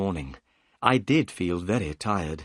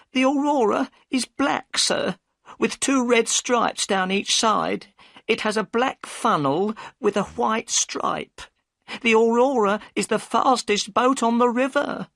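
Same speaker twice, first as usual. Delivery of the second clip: high, anxious woman's voice; a worried wife pushing him away; upset boatman's wife; 12 semitones higher, 3 dB louder than usual. A narrator's reading. Mrs Smith describing the boat.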